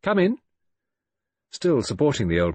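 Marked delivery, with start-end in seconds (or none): 0.0-0.4 high, efficient nurse calling out; brisk, efficient. A narrator's reading. Nurse answers a tap on the sickroom door, brisk and efficient. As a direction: call out briskly in a much higher voice. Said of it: "brisk, efficient voice called".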